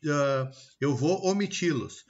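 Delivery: usual speaking voice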